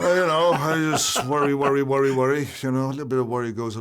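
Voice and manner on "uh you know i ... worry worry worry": worked-up worrying. Real, not acted. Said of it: "a typical Navan man".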